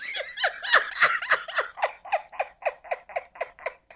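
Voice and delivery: long, very high laughing fit; helpless laughter. Real, not acted. Co-host cracking up after imitating the yell, helpless laughing. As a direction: crack up completely at your own imitation.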